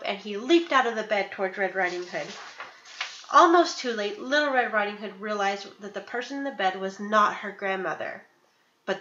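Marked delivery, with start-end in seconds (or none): none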